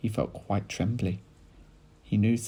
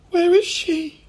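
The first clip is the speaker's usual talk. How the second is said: high, loud worried cry; alarmed cry; 11 dB louder, slower than usual. A narrator's reading. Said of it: "cried Missus Cranky".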